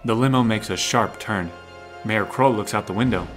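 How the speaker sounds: normal talking voice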